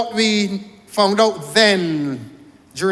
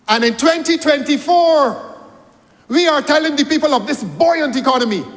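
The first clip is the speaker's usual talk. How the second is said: rising indignation; 8 semitones higher, 5 dB louder than usual. Real, not acted. Climax of parliamentary attack.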